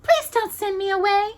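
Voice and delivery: high pleading princess voice. A narrator's reading. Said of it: "she pleaded".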